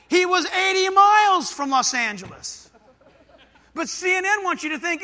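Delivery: high, loud outburst of disbelief; indignant exclamation. Real, not acted. Preacher exposes reporter's false location to congregation, loud indignant exclamation. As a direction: call out the absurdity, loud and high.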